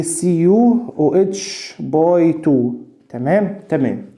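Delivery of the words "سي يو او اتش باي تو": سي يو او اتش باي تو spelled out letter by letter, very slowly; teacher dictating a formula to students, slow and deliberate; slow dictation. Shown as a demonstration. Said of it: "انا قلت ايه؟".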